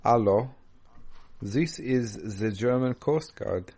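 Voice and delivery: put-on light German accent, slow and stiff. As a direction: play the German Coast Guard, slow. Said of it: "German accent".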